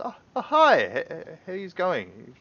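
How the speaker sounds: high stammering nervous greeting; flustered friendly guard, stumbling over words; nervous Ander. Acted. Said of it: "Ander turns around and says".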